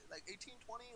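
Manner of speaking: ordinary talk, nothing marked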